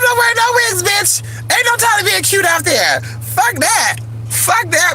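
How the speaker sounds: very high, comic whiny squeal; squealed refusal. Pretend. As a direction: protest it in a high falsetto.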